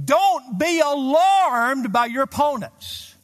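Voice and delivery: fervent slow proclamation; preaching at full force. Real, not acted. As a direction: proclaim the verse with weight. Quoting verse at full force.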